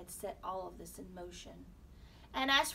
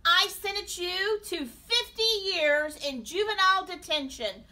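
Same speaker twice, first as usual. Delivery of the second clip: loud, raised courtroom pronouncement; thundering judge; 10 semitones higher, 14 dB louder than usual. A narrator's reading. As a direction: hand down the sentence with booming force. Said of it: "thundered the judge".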